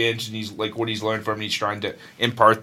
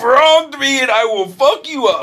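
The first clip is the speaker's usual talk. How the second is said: mock rage roar; 13 semitones higher, 7 dB louder than usual. Friend mocks old angry Kratos in a hammed-up roar. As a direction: ham up a raging god's threat.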